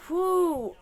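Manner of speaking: loud overwhelmed exhale; sharp loud whew of strain; heavy whew. Real, not acted. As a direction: blow it out hard, shaken.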